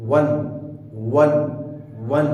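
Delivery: वन repeated as a clean wun; right pronunciation. Shown as a demonstration. Teacher drills the correct वन for his students. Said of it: "ये है English की खूबसूरती".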